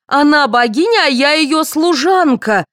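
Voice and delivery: high, indignant repeat, all at full pitch; outraged. A narrator's reading. Actress repeating her humiliating role for all, outraged. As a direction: throw the line back in outrage.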